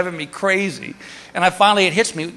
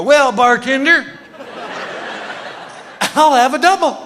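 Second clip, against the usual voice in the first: drugged bar order; 8 semitones higher than usual. Retold or imitated. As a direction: order merrily as if doped up. Drugged order to nurse as bartender.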